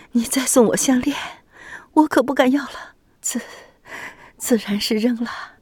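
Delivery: panting, high whispered speech; audible breathing. A narrator's reading. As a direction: gasp the words out, breathing hard. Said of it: "喘着粗气说道".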